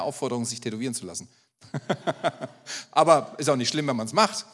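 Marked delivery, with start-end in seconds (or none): 1.7-4.5 laughing. Real, not acted. Joke about tattoos.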